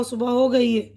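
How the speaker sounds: ordinary talk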